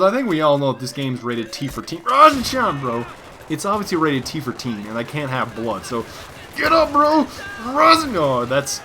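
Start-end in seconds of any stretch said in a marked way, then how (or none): 2.0-3.1 calling out. Real, not acted. Gamer calling out to teammate. 6.6-8.2 high, loud call to a downed teammate. Real, not acted. Gamer calling teammate to revive.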